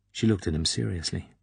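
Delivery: ordinary delivery, nothing marked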